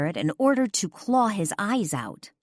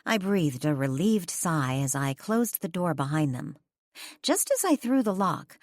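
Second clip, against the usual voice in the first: plain reading voice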